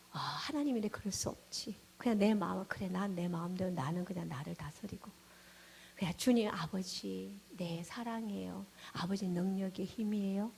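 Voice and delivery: low, subdued mutter of self-restraint; soft resigned prayer that keeps emotion down; suppressed feelings. Retold or imitated. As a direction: mimic holding it in and praying dutifully. Acts out suppressed, dutiful prayer.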